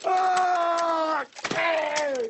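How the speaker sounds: high shouted ah; panicked scream. Acted. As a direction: scream as the car goes over. Crash scream.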